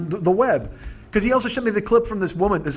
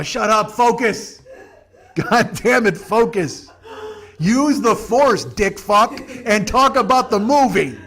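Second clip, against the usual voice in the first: louder, slow, laughing mock order; mock scolding yell; 2 semitones higher, 4 dB louder, much slower than usual. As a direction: drill him back on task through laughter. Imagined fans scolding him, laughing.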